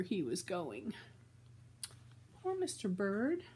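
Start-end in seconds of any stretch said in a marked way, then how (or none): none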